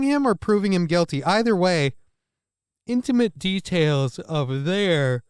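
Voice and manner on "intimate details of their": talking through a yawn; yawning mid-sentence. Real, not acted. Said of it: "I stop yawning and hiccuping?".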